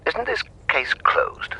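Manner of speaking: a pointed, slow whisper. Acted. Expert needling the detective on the phone, slow whisper.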